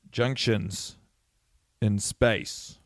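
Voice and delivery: ordinary talk, nothing marked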